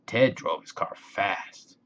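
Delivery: plain reading voice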